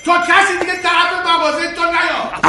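high-pitched angry threat; angry shouting. Acted. Customer threatening the tailor's reputation in the bazaar, angry shouting. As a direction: call it out as a public threat. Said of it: "داد بزنم".

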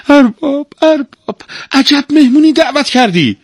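very high, loud servant's outcry; shrieking alarm. A narrator's reading. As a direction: wail a complaint at the master. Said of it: "جیغ کشان نزد اربابش دوید و فریاد زد".

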